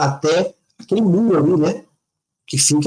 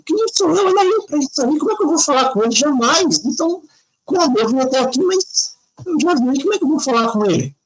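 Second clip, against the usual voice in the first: put-on desperate child; 7 semitones higher than usual. Boy despairs about reaching president.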